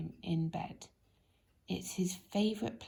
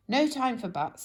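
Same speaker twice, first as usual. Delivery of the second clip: louder, curt no-nonsense; brisk dismissal; 5 semitones higher, 6 dB louder than usual. Acted. Mister Busy cutting off Lazy's protest, curt and loud. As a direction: cut him off briskly. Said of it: "said Mister Busy".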